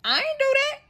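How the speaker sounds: husband insisting on the phone, high mock-innocent voice; husband's denial. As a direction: play him whining his innocence. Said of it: "Husband, you know what".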